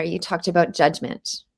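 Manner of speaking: ordinary delivery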